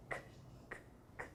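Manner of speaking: short voiceless K, k, k clicks; k sound. Shown as a demonstration. Teacher showing pupils the first sound of cachorro, soft k. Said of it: "Esse som é de qual letra".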